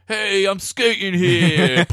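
slow, high mock Brooklyn holler; comic Dutch skater with a drawn-out Brooklyn accent. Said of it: "Dutch accent sounds kinda like a Brooklyn accent".

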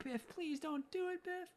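high, soft, slow imitation of a woman begging; mock pleading. Lorraine pleads with Biff, retold.

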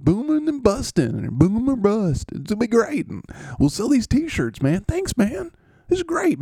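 cheerful receiver thanking the podcast host; goofy high dude voice. Acted. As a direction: play a giddy, friendly jock. C Ridley raving.

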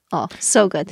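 ordinary delivery

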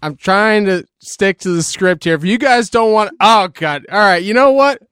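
exasperated outburst. Acted. Mock frustration over script.

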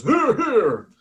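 high loud call from the crowd; rowdy tavern cheer; patron shout. Acted. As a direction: cheer it out from two seats back.

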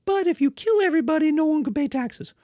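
high, loud, quick persuading; a lord hurriedly selling taxes to barbarians; eager lord pitch. Acted.

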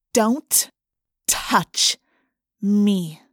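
cold steel. A narrator's reading. Rachel over the pinned girl, cold steel threat. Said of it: "in a voice of cold steel".

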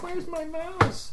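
high, slow whiny plea; mock-plaintive high voice; whiny mouse-seeker. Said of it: "you're gonna be like".

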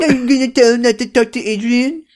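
high, sing-song mimicry; mock him back, high and whiny. Leo mocking his son's question, high mocking voice. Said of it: "a mocking voice".

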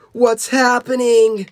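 high, drawn-out panicked character cry; high acted scream. A narrator's reading. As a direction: scream it slowly as the story's hero. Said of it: "Tyler screamed".

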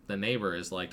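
normal talking voice

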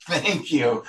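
chuckling thank you to the host for the save; laughing thanks. Real, not acted. Laughing at the host's good save.

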